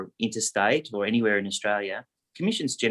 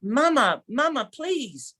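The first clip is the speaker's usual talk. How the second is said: much higher, slow, pleading son; embarrassed son; much slower than usual. Retold or imitated. Embarrassed son pleads with his mother, much higher and slow. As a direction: beg your mother to stop, drawn out.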